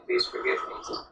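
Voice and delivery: hushed, mild tearful begging; tearful plea. Retold or imitated. Servant on his knees begging for forgiveness, hushed and tearful. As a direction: whisper the plea as if weeping. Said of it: "he's weeping and he's saying".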